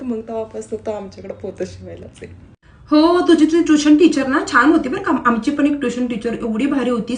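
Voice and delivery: ordinary talk, nothing marked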